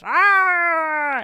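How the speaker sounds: long, loud, high "aah"; mock monster yell. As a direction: yell like a risen zombie, hammed up. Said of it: "looking like that old man and goes".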